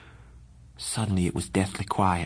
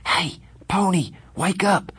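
higher, urgent voice of Johnny shaking a sleeping friend; urgent wake-up call; 10 semitones higher than usual. Acted. Johnny shaking Pony awake, higher urgent call. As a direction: rouse him, call him awake.

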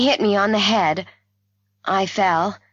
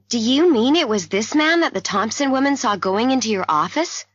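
higher-pitched incredulous question; startled realisation; 7 semitones higher, 3 dB louder than usual. A narrator's reading. Narrator questioning the doctor about the man seen, startled. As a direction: check it in disbelief.

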